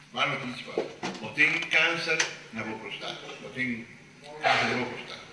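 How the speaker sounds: a hint of a solemn doctor; grave hushed news. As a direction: break bad news quietly. Doctor delivering grim news.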